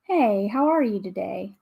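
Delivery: ordinary delivery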